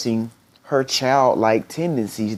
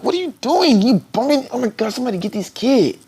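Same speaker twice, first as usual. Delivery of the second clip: much higher mock outrage at the kid; hammed-up annoyed scolding; angry serious person; 12 semitones higher than usual. An angry, serious person scolding a kid who bumped them, mock outrage. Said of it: "if you're an angry, serious person".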